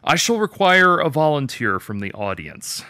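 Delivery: high and loud, commanding a crowd; bold stage announcement. A narrator's reading. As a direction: address the whole audience from the stage. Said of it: "began in a voice that w-, surprised even himself".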